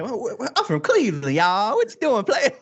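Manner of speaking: high-pitched, blustery comic impression; exaggerated stammering outburst in a put-on voice; Steve Harvey. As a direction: do a hammed-up celebrity impression.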